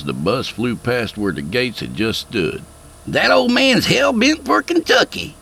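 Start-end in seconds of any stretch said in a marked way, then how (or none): 3.1-5.4 put-on high twangy good-old-boy voice; amused country quip. A narrator's reading. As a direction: Skeet watching the bus in the mirror. Skeet remarking on speeding bus.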